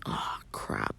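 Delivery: soft whispered grumble; whispering. Acted. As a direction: breathe it out, let down. Disappointed mutter to himself.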